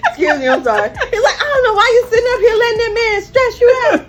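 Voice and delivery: high, loud, teary laughter; laughing to tears. Real, not acted. Wife retelling husband's words, laughing to tears. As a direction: laugh so hard it turns to crying.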